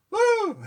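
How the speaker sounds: very high, loud falsetto whoop; shocked whoop. Retold or imitated. Mick grabs her, a high loud whooping shriek. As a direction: squeal like a startled woman. Reaction to being grabbed.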